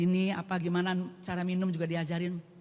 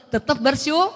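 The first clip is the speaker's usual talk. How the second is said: emphatic declaration; 7 semitones higher, 11 dB louder than usual. Real, not acted. Climax of defiant gratitude list.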